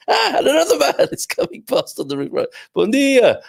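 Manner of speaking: very high, squeaky laughter through the words; helpless laughing. Real, not acted. Streamer sees another man pass on the roof, cracking up. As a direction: crack up while trying to greet someone.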